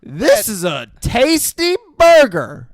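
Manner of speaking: every word of "this is a tasty burger" drawn out, high and loud; hammed-up movie quote, slow and booming; overly emphasized. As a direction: savor it slowly, punching each word hard. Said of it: "overly emphasizing every word".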